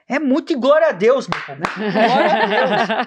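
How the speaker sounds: laughing praise. Real, not acted. Preacher to friends, praising God with a laugh.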